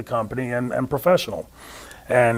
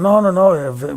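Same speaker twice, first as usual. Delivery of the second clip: higher-pitched quick dismissal; retold objection; 6 semitones higher, 4 dB louder, slower than usual. Wrestler objecting to the planned finish, retold protest. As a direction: cut in with a mild protest. Carl rejects a proposed finish.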